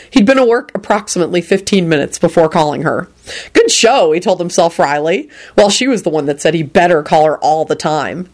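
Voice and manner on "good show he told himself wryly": plain reading voice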